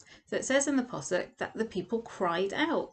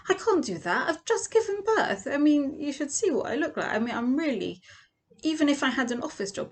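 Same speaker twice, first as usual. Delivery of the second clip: high, pleading panic; mock panicked new mother pleading; panicked protest; 6 semitones higher than usual. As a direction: beg in disbelief, voice climbing high. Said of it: "You're like".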